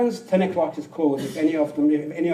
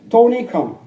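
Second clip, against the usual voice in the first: loud, higher call across the store; loud summons; 6 semitones higher, 12 dB louder than usual. Retold or imitated. The supervisor calls Tony in to fire him, loud. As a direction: call him over, commanding.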